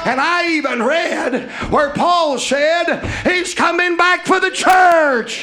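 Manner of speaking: loud, rhythmic preacher's cadence rising to a cry; full-force preaching. Acted. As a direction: preach it out to the whole church.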